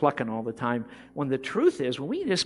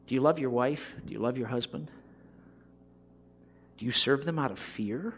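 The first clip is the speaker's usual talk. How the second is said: usual speaking voice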